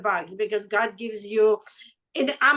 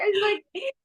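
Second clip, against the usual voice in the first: crying; 10 semitones higher than usual. Real, not acted. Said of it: "I'm crying and I'm laughing".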